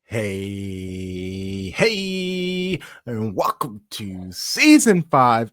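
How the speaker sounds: slow, high, drawn-out welcome; show intro announcer. Acted. Podcast host opening the show to listeners, slow announcer voice. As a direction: stretch every word like a radio host.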